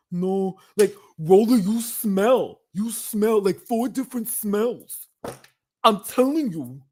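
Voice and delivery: playful imitation of a queen; lightly voiced sassy queen; mock impression.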